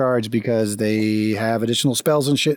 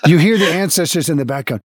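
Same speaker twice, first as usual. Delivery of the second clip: normal talking voice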